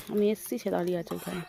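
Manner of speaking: ordinary talk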